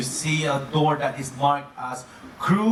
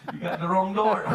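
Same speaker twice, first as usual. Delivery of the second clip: laughing through the words; 3 semitones higher than usual. Real, not acted. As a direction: giggle as you say it. Laughing at wrong door.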